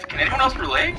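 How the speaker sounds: high pleading question to the audience; mock plea. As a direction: beg the crowd to agree.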